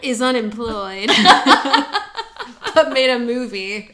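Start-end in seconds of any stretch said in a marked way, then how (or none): 1.1-2.7 bright high laugh, louder; high burst of laughter. Real, not acted. Laughing at her own joke about her brother.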